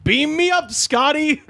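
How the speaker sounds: high-pitched mock yell; imitated screaming. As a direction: call it skyward in a high voice. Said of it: "throwing things into the street and screaming".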